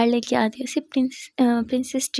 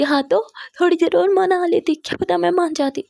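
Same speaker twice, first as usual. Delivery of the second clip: teary sulk; 7 semitones higher, 6 dB louder than usual. Acted. Jia sulking while crying.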